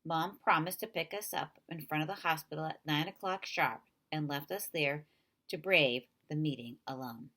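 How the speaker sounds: ordinary delivery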